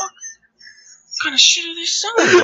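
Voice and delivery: high, slow, laughing mock outrage.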